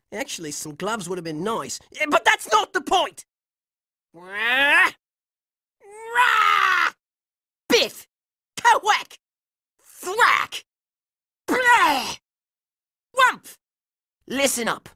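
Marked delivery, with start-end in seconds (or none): 4.1-6.9 drawn-out "rah" battle cry; growled fighting yell; battle roar. Acted. 7.7-13.5 punchy comic-book hit noises; short, exclaimed impact words; fight sound effects. Acted. Voiced blows in a fight.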